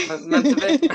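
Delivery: high and loud, gleefully repeating; playful sing-song echo. Real, not acted. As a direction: echo the phrase back in delight, way up high. Echoing the other speaker playfully.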